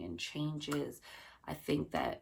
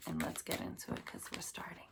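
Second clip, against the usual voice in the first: whisper; 7 dB quieter, faster than usual. Real, not acted. Whispered quick lead-in to reading.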